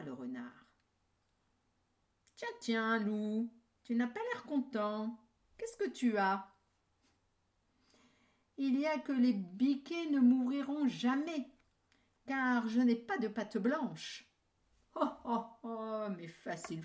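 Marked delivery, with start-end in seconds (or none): 2.4-6.5 higher, louder sly fox. Acted. Fox greets wolf. 8.6-14.3 louder, higher sulking wolf; grumbling wolf. Acted. Wolf complains.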